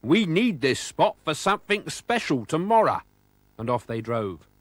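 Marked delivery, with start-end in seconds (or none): 0.0-3.0 warning. A narrator's reading. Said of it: "warned McSneak".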